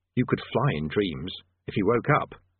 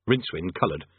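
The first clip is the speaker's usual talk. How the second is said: usual speaking voice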